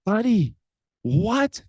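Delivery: high-pitched, startled protest; dismayed disbelief. Retold or imitated. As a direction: react with stunned, pleading disbelief. Dad startled by son's sad apology.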